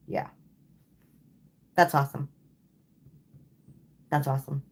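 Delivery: normal talking voice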